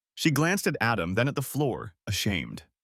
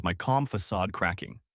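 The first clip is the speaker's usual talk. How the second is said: usual speaking voice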